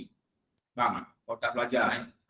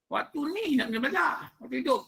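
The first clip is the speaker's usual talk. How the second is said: lightly pitched soul voice; 10 semitones higher, faster than usual. Retold or imitated. Voiced lesson from the afterlife.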